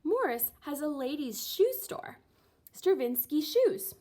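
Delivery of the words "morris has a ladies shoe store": plain reading voice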